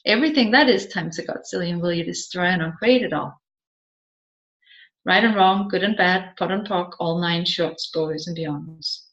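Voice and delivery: a practised incantation, quick then trailing away; rapid clearing statement. Acted. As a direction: rattle off the formula fast, fading to a low murmur.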